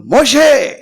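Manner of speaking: high, loud, booming God rebuke; God rebuking. Acted. God scolds weeping Moses, high and loud. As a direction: thunder it out as an offended God. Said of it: "అప్పుడు దేవుడు అంటున్నాడు".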